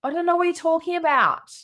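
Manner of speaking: put-on high-pitched child denial; six-year-old daughter. Daughter with the memory gone, high child voice. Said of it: "She goes".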